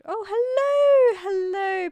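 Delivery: very high, loud, drawn-out hello; excited squealed greeting. Real, not acted. Streamer greeting a newcomer in chat, excited squeal. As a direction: squeal a long, delighted welcome.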